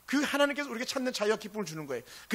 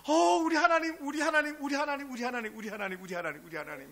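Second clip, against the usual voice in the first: excited calling; 6 semitones higher, 4 dB louder than usual. Retold or imitated. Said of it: "개시계에 이거예요".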